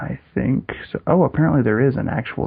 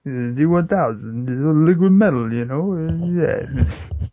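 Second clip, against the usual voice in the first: higher, slurred action-star voice; Sylvester Stallone; 5 semitones higher, slower than usual. Acted. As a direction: ramble it like a dopey boxer. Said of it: "Terminator Two starring Sylvester Stallone".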